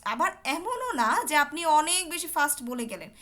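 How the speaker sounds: ordinary talk, nothing marked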